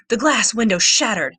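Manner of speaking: ordinary delivery, nothing marked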